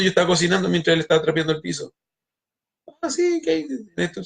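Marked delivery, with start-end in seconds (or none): 3.0-4.2 high, put-on voice; a lightly voiced neighbor; mock casual question. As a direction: toss it off in a different voice.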